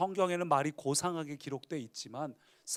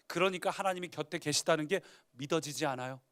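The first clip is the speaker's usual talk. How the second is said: normal talking voice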